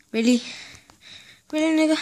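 out of breath, panting; breathing hard between words. Real, not acted. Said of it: "Sorry, I'm breathing so hard".

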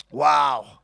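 deep, hushed wow of wonder; low awed wow. Acted. Preacher marvels at the moment.

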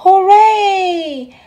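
high, loud cheer of triumph; a boy's joyful whoop; Stanley cheers from down the shaft, loud and high; cried out. Acted. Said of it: "Stanley cried out".

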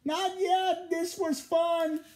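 high, gleeful firefly voice. A narrator's reading. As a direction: gloat like a naughty firefly.

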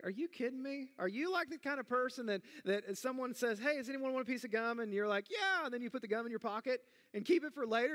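hammed-up outrage at his son; upset disbelief. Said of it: "I was kind of upset".

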